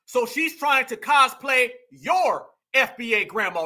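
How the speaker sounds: ordinary talk, nothing marked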